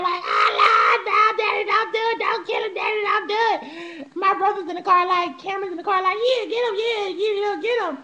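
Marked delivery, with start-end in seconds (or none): 0.2-4.2 loud, high hammed-up tearful pleading; mock crying. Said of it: "I'm in the car crying". 6.2-8.0 higher-pitched imitation of a cheering kid; retold egging on. Acts out brother egging dad on.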